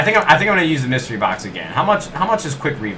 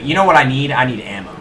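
ordinary delivery

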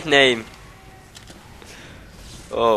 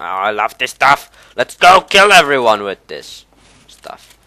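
ordinary talk, nothing marked

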